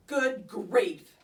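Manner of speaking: a fed-up teacher's sigh of a complaint; unhappy. Acted. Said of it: "in a very unhappy voice".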